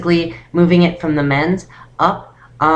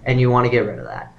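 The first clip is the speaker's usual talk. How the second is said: low, resonant chest voice; deep voice; 5 semitones lower than usual. Shown as a demonstration. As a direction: speak deep so it vibrates in the chest. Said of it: "have this really deep voice".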